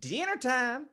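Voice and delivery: high call to dinner. Acted. Father calls the family down to dinner, high call. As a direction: sing it up the stairs.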